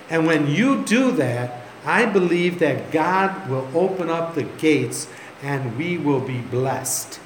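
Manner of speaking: slow, deliberate preaching; solemn appeal. Retold or imitated. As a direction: spell out the appeal phrase by phrase. Pastor appeals for sacrificial giving.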